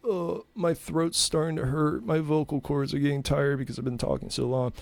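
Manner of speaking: normal talking voice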